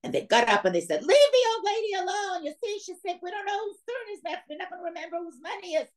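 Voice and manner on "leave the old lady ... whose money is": much higher, put-on voice of drunk card players; drunk men. Drunk card players telling the conductor to leave her alone, in a much higher put-on voice.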